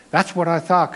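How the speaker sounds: ordinary talk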